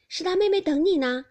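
high, loud call. A narrator's reading. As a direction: call someone away brightly and loudly. Baochai calling Baoyu away.

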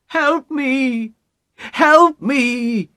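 high, drawn-out cry for help; pleading high-pitched wife's call. A narrator's reading. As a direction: call out to your husband, building. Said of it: "she called to her husband".

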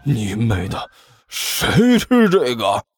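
mildly angry swearing, slowing at the end; drawn-out indignant retort; cursing. Acted. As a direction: curse the enemy in disgust. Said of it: "尼采破口大骂".

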